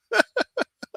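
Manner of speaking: high breathy giggle; a quick squeaky laugh. Real, not acted. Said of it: "when I just start laughing".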